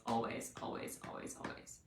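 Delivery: always trailing off into a whisper; fading to a whisper. Real, not acted. Repeating always to herself as it fades to a whisper.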